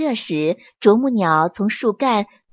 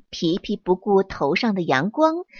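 usual speaking voice

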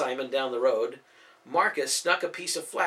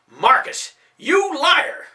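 much higher, much louder enraged shout; furious yell; 14 semitones higher, 11 dB louder, much slower than usual. Acted. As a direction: roar at the lying son. Father yells at thieving son.

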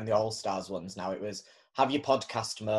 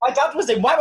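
high, loud mocking imitation; mocking the queen; 11 dB louder, faster than usual. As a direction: squeal the reveal like the queen. Mimicking the queen's reveal.

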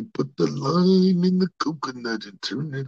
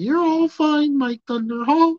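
high, teasing compliment; fan's swooning praise; high flattering voice; 13 semitones higher, 5 dB louder than usual. Retold or imitated. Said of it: "Y'all ain't gonna tell me".

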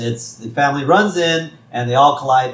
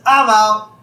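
loud, higher-pitched mock call; Barney; 9 semitones higher, 6 dB louder than usual. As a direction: call it out high and loud, as a bar buddy folding. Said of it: "And Barney says".

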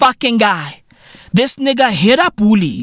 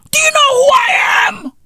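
arrogant boast. Retold or imitated. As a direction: shout it like a hurt big shot. Mocking scammer boast.

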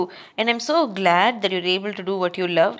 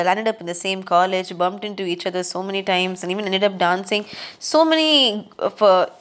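normal talking voice

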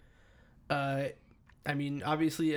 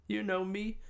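laughing; 6 semitones higher, 4 dB quieter than usual. Real, not acted. Teasing reply, laughing.

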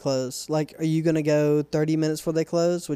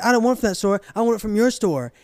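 mock stubborn customer; 5 semitones higher, faster than usual. Customer refusing another store, huffy mock insistence. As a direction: insist like a stubborn old man, pitched up. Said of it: "He said".

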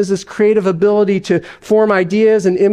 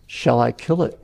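a touch lower, steady angel voice; an unhurried, grave angel; calm angel; 6 semitones lower, 5 dB quieter than usual. Acted. Angel offering help to the ghost, calm and a touch lower.

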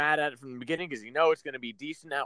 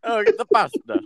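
mock Italian accent; 8 semitones higher, 9 dB louder than usual. Joining the Italian bit.

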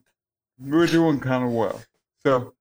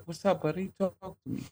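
softer, higher coaxing voice; gentle dad; 6 semitones higher, 8 dB quieter, faster than usual. Retold or imitated. Dad asking his upset young son to talk, gentle. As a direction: coax an upset little boy softly.

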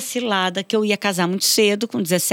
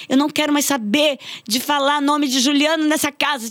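mock teenage outburst; 6 semitones higher than usual. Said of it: "eu falava".

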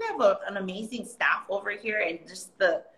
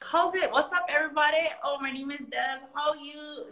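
Hawaiian pidgin greeting; 6 semitones higher than usual. Retold or imitated. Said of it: "my pidgin was so good".

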